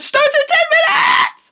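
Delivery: high, loud, drawn-out squeal of excitement; excited shout. Real, not acted. Vlogger telling viewers her favorite show starts soon, excited shout. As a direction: shout it out, stretching the words in sheer glee.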